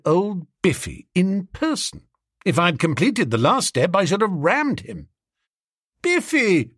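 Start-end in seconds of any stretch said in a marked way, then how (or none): 6.0-6.7 high, falling exclamation of greeting; delighted cry. A narrator's reading. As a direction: hail an old pal, pitched high. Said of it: "I cried".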